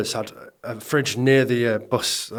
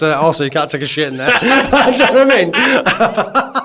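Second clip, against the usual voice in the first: ordinary talk, nothing marked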